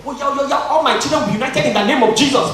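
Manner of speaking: high, loud, quick mock declaration; imitated praying mother. Mother praying unity over her children, imitated fervour. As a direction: declare it like a fervent praying mum.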